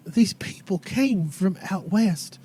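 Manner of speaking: put-on folksy old lady, haltingly telling what happened; old townswoman.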